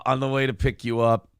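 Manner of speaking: ordinary delivery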